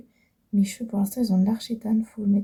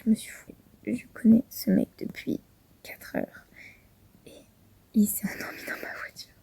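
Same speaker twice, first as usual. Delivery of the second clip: whispering over a crib. Real, not acted. Man asleep in her car.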